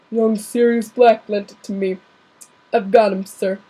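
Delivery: big friendly giant reporting in; lightly held gamekeeper voice; Hagrid voice. Acted. Reader keeps Hagrid voice.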